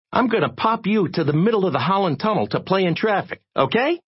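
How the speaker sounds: fed-up tirade rising to a threat; exasperated threat. A narrator's reading. Jay warns and threatens Digger.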